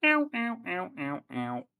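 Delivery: string of yelped ows, starting high and loud; little robot saying ow as it is hit; robot's cries of pain. Acted. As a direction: yelp in repeated bursts, dropping off. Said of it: "As you can hear".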